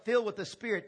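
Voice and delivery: usual speaking voice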